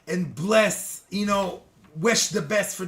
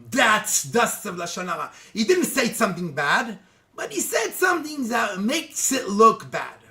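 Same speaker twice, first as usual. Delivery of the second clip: ordinary talk, nothing marked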